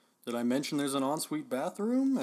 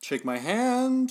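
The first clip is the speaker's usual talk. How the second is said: slow eerie; 10 semitones higher, much slower than usual. Acted. Agent asks handshake, drawn out.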